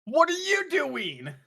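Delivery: high, drawn-out indignant shout; outraged yell. Acted. Character confronting the one who tackled them, outraged shout. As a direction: yell it slowly in mock outrage.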